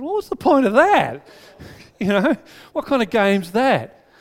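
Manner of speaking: high, loud incredulous protest; indignant kid. Retold or imitated. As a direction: scoff at the pointless rule. Indignant child arguing.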